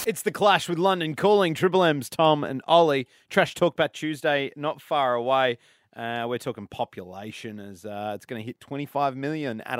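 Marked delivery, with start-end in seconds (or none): 0.1-5.6 loud, slow station ID; radio announcer. Acted. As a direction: punch it out like a rock radio presenter.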